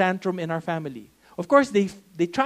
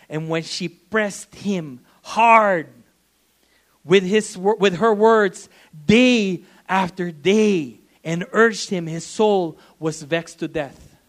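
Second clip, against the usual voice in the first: scripture reading; 7 dB louder, slower than usual. Acted.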